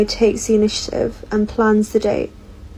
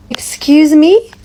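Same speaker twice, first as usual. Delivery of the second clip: indignant disbelief; 5 semitones higher, 6 dB louder, slower than usual. Real, not acted. Reacting to the Netflix and chill offer.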